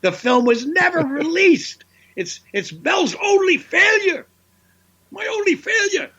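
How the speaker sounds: very high, mock-anguished shout; hammed-up high-pitched wail; comic outcry. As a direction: cry it out as a punchline. Punchline wailed about the failed film.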